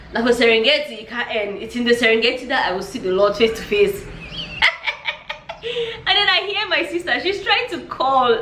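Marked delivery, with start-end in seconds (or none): 4.1-6.0 laugh. Real, not acted. Laughing at her fear.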